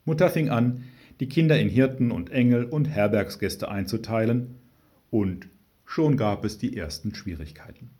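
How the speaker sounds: plain reading voice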